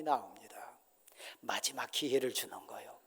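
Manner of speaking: breathy whispered aside. Acted. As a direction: whisper it to the congregation. (속삭이듯)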